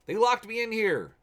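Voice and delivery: high, loud call for help. Acted. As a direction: shout through the wall to be let out. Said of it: "Luke says".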